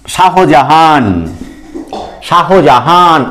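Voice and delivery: শাহজাহান said slowly with the "ho" sounded, sha-ho-ja-haan; full long pronunciation. Shown as a demonstration. Teacher shows pupils the name too long to say, slow full pronunciation. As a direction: draw out every syllable of শাহজাহান, h included. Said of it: "আমরা এত বড় নামটাকে উচ্চারণ করতে পারি না".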